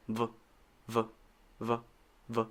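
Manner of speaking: short "V" said as a bare vuh; basic V sound. Shown as a demonstration. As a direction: voice a brief "V" sound, vuh, not the letter name. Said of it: "the basic, basic sound is just".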